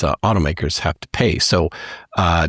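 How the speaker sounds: normal talking voice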